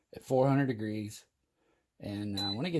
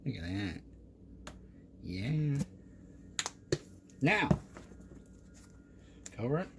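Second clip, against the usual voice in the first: usual speaking voice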